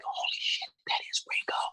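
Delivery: imitated stunned whisper, no voice at all; awed whisper. Tour manager recognises [name] on speakerphone, awed whisper. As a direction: whisper it like a starstruck fan.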